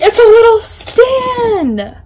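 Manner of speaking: high, drawn-out excitement; delighted squeal. Real, not acted. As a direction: squeal it slowly, thrilled. Delighted by unboxed item.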